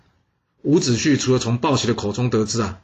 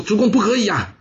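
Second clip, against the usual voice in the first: higher, alarmed plea to stop; urgent protest; 9 semitones higher than usual. Acted. Bao Xi begging his lord to spare Wu Zixu, higher urgent cry. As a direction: leap in to stop the sentence.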